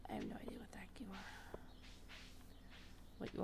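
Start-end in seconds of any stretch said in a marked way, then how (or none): 0.1-1.6 whispering. Real, not acted. Whispering to a cute creature.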